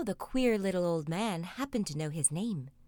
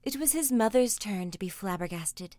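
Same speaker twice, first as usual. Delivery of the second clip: ordinary delivery, nothing marked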